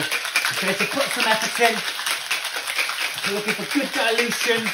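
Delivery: talking while shaking hard. Real, not acted. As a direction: push the words out mid-effort.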